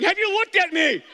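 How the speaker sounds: high, loud, preening giraffe; a giraffe boasting about being a neck model; vain giraffe. Pretend. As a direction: show off your long neck. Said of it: "giraffes going".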